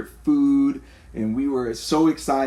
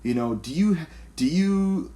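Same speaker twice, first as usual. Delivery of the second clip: lightly higher, teasing mock question; 5 semitones higher than usual. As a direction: play the rude stranger for a laugh.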